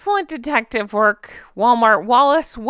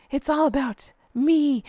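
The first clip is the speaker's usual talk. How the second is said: mock self-centred voice, higher; Meghan; 4 semitones higher than usual. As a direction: lightly mimic someone making it all about herself. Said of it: "she's making it about herself".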